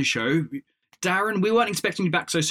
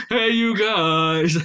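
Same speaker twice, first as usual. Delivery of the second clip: Goonies quote; 3 semitones higher, 4 dB louder, much slower than usual. Retold or imitated. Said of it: "It sounded like".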